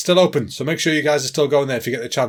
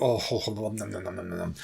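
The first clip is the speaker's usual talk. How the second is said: soft, breathy nom-nom eating noises; mock munching; 6 semitones lower, 10 dB quieter than usual. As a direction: quietly savour it like a cartoon eater. Savouring remembered dessert.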